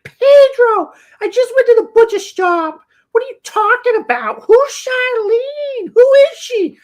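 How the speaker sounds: loud, screechy falsetto outburst; mock a mother blowing up at her son; detonating mom. Mom exploding at her son over Charlene, shrieking falsetto. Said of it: "then she absolutely detonates".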